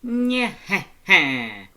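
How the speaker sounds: slow, high, loud nyeh-heh-heh cackle; booming laugh. A narrator's reading. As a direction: let out a big booming skeleton laugh. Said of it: "a bright and booming".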